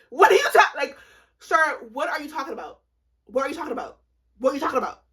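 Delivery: loud, high-pitched incredulous outburst; baffled disbelief. Retold or imitated. Retold baffled reaction to his question.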